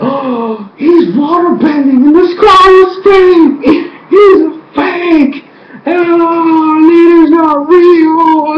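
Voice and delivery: hammed-up stunned crowd; crowd in uproar. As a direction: shout it out in horrified excitement, drawn out. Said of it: "everybody's like".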